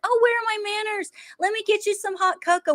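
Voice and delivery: sweet as pie, hiding poison; fake hospitality.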